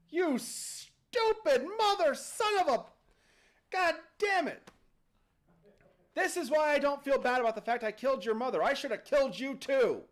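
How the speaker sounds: high, loud furious tirade; enraged dad. Acted. Dad rages at his son over the genie, furious. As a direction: explode into cursing, then rant. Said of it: "And Dad stands up".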